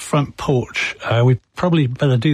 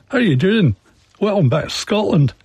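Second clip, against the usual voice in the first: put-on light Scottish accent, pitched up high; a cheerful Scottish greeting in a mock Scottish accent; imitating Scottish accent; 7 semitones higher than usual. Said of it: "talk with a very different accent".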